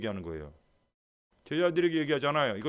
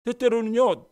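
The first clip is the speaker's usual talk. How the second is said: ordinary talk, nothing marked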